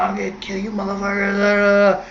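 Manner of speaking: lightly voiced stranger freaking out; imitating a raving stranger. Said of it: "So he's freaking out".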